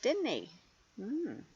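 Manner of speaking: plain reading voice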